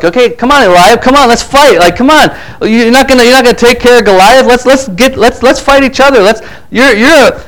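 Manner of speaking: high-pitched, insistent mock fight-picking; acted mad challenge. David challenging his brother to fight instead, mock-mad. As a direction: goad him into a fight, pitched well up. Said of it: "he could have gotten really mad".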